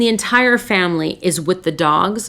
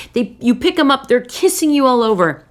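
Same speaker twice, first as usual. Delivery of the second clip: ordinary delivery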